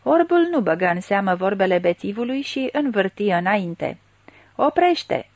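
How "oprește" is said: high-pitched, restrained yell of command; narrator's contained roar; roared order. A narrator's reading. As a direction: call out a sudden order to halt. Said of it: "răcni ăsta".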